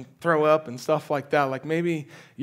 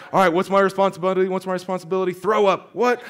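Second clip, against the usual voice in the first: fast, higher, louder, ending in shock; racing inner thoughts; 5 semitones higher, 6 dB louder, faster than usual. Retold or imitated. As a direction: rattle off your checklist, then balk. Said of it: "It's like".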